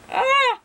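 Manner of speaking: high, loud sharp gasp before a sneeze; sudden loud pre-sneeze gasp; sneeze build-up gasp. Real, not acted. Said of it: "I wish I would just sneeze".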